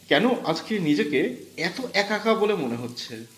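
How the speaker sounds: usual speaking voice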